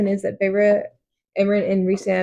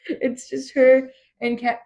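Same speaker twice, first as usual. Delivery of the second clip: ordinary delivery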